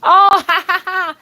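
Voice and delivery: high, loud burst of laughter. Real, not acted. Laughing loudly at her own joke to viewers.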